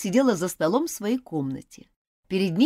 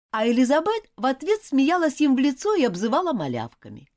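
usual speaking voice

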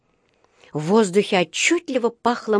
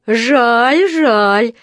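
loud, raised, drawn-out mock sympathy; глумливо; 4 semitones higher, 7 dB louder than usual. A narrator's reading. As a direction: jeer while pretending to sympathise. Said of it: "глумливо посочувствовал вахтёр". (глумливое сочувствие, громко)

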